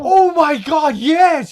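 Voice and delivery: loud, high burst of delight; real excited outburst; excited recognition. Real, not acted. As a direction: blurt it out, thrilled to remember. Suddenly recalls the same character.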